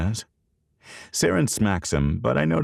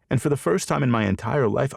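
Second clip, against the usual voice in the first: usual speaking voice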